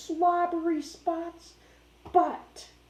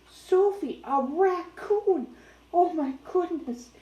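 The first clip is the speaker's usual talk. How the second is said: higher, slow, amazed; excited; 5 semitones higher than usual. Real, not acted. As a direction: tell the dog with wide-eyed wonder. Excited aside to the dog.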